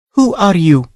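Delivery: a startled lady challenging a stranger; lady on deck confronting a dripping intruder, demanding; Sancha demanding. Acted. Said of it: "she demanded".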